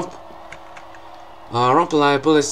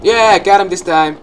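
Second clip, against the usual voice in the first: loud, higher burst of triumph; triumphant cheer; 4 semitones higher, 8 dB louder than usual. Real, not acted. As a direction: celebrate finally landing it. Succeeds after retries.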